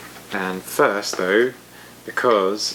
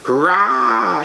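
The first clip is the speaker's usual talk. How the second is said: a louder, rising shrieked cheer; shrieking sphinx; 5 semitones higher, 5 dB louder than usual. A narrator's reading. Sphinx and griffin shrieking a cheer for the dragon. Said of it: "shrieked a sphinx and a griffin".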